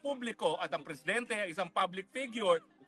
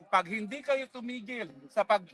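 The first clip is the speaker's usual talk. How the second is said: normal talking voice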